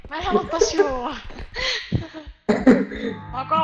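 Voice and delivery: high, loud plaintive complaint; whining protest. Real, not acted. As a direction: whine about being made to do it. Objects to drinking again. (valittava vastustelu)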